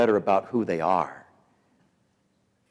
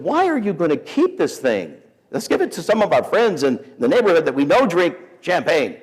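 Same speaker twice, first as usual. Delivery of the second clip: higher-pitched pleading objection; imitated exasperated wife; 6 semitones higher than usual. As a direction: protest in a raised wife voice.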